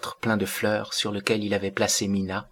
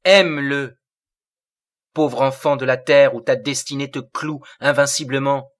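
louder, forceful command; terrible command; 3 semitones higher, 6 dB louder than usual. A narrator's reading. The terrible Séraphitus orders Mina to love Wilfrid, forceful. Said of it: "dit le terrible Séraphitus".

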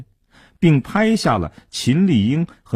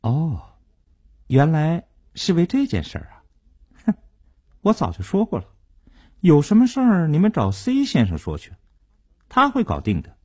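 higher-pitched, dismissive debtor's bluff; trying to sound tough; 6 semitones higher than usual. A narrator's reading. Debtor fobbing collector off onto Mr. C, putting on toughness. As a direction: brush them off with forced toughness. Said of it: "尽量用强硬的口吻说".

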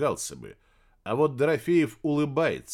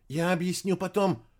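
ordinary delivery

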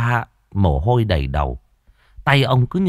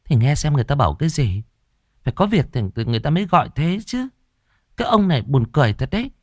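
high, fast nagging; snappish wife; 7 semitones higher, much faster than usual. A narrator's reading. Wife urges husband to answer the phone, snappish. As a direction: scold him in a quick, raised-pitch voice. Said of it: "bà gắt".

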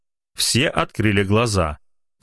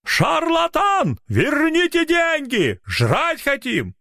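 angry crowd; much slower than usual. Acted.

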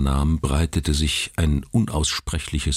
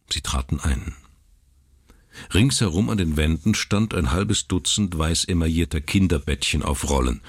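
ordinary delivery, nothing marked

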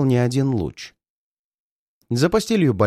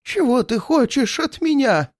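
much higher, feigned terror; trembling voice; 12 semitones higher than usual. Acted. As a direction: cry out as if terrified of a ghost. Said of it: "спросил он дрожащим голосом".

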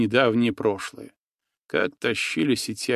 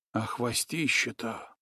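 much softer, marvelling „хвостище-то”; awed hush; 10 dB quieter than usual. A narrator's reading. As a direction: breathe out „хвостище-то” in wonder. Nastya marvels at tail.

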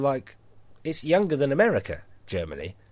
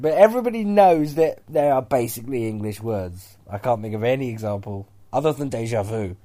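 usual speaking voice

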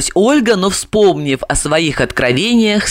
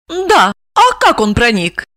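higher, puzzled female voice; 6 semitones higher than usual. A narrator's reading. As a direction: repeat his words in dawning shock. Olga echoes the question.

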